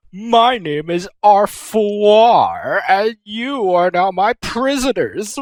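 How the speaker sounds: slow, drawn-out mock-villain introduction; villain Arfoire. Arfoire to the captured goddesses, slow mock-villain gloat. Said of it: "she's like".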